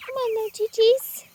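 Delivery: high, lilting coaxing call to the hens; sing-song chicken call. Real, not acted. Calling chickens to bed.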